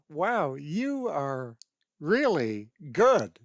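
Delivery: slow, drawn-out admiration; amazed praise. Retold or imitated. Grandma to the violinist after playing together, amazed.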